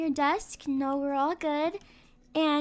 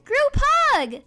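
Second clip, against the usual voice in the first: high, loud cheer for a group hug; excited call; 8 semitones higher, 5 dB louder than usual. Acted. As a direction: shout it out gleefully to the whole family. Family calls for group hug.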